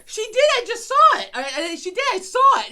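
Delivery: high, loud protest; wife insisting she saw it; insistent yell. Retold or imitated. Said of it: "And I'm like".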